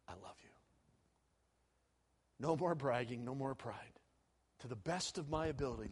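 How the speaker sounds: soft, low, humbled confession; a broken man answering quietly; humbled murmur. Acted. Restored Peter humbly telling the Lord he loves him, soft and low.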